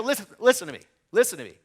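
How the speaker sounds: loud urgent listen to me; urgent insistence. Retold or imitated. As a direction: make him stop and hear you. Calming panicked partner, urgent.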